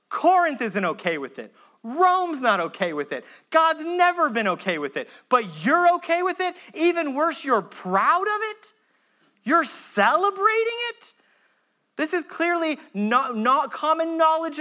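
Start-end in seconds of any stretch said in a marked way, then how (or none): 0.1-11.1 rising higher with each question, disbelieving; incredulous rebuke. Retold or imitated. Paul rebuking the Corinthian church, rising incredulity. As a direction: build from stern to outraged disbelief. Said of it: "Paul says".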